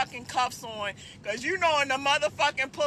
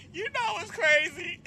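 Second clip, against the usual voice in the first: laughing; 6 semitones higher than usual. Real, not acted. Laughing through it.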